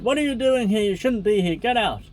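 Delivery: put-on high, loud furious shout of an old woman; angry yelling. A narrator's reading. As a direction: yell her out of the room. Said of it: "very angry, yelling".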